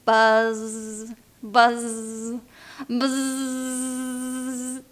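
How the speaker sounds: long droning buzz; fading drawn-out buzz; bee buzz. Shown as a demonstration. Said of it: "The bee".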